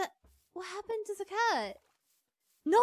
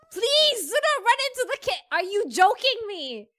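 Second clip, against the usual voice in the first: loud, high exasperated yell; exasperated plea; 3 semitones higher, 8 dB louder than usual. Real, not acted. Player begging the cat to avoid the cave, exasperated. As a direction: plead loudly in disbelief.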